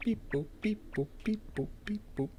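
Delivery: high soft beeping imitation; beep boop sound. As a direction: chirp the beeps like a machine. Mimicking game sound.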